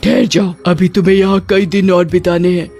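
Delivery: stern witch gatekeeper giving orders; commanding witch guard. Acted. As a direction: order the newcomer back. Gatekeeper witches stop Lali.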